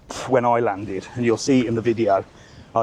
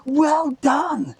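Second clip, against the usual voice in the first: very high, slow, cooing praise; drawn-out high-pitched mock praise; mock gushing parent; much slower than usual. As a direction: ham up a parent fawning over the child. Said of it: "And they were like".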